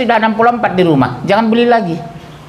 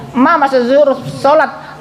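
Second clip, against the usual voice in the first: husband calling; 8 semitones higher, slower than usual. Retold or imitated. Husband calls wife to prayer.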